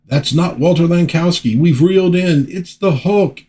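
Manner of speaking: high, urgent comic-book hero cry; high and dramatic, announcing the threat; alarmed comic character. Acted. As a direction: call it out in high-pitched alarm.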